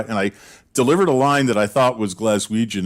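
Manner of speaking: usual speaking voice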